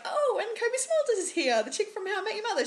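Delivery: high, quick mock announcement; mock announcer. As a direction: chirp it like a cheesy host. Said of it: "they're just like".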